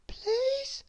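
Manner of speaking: tiny, high timid whisper; faint little whispered plea. A narrator's reading. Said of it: "he whispered".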